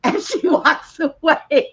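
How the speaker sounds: can't stop giggling while saying it; laughing. Real, not acted. Laughing while telling punchline.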